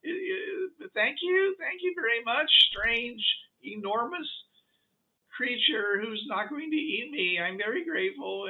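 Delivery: high, fluttery grateful voice; high creature voice politely declining food; polite alien creature. Acted. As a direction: thank her nervously and politely. Creature talks to the lady.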